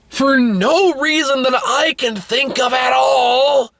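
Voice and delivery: high-pitched, drawn-out exasperation; exasperated disbelief. Real, not acted. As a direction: stretch it out in baffled protest. Ranting about a baffling scene.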